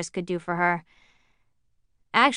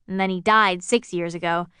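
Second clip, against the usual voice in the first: plain reading voice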